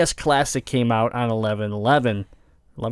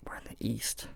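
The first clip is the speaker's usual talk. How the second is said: whispered aside; 4 semitones lower, 19 dB quieter than usual. Real, not acted. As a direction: whisper the correction under your breath. Jokey correction aside.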